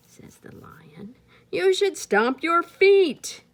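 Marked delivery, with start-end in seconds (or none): 1.5-3.4 elephant. Acted. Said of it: "the elephant".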